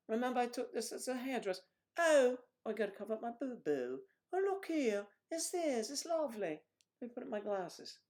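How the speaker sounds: high-pitched hairdresser voice; hairdresser character. Acted. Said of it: "when I play the hairdresser".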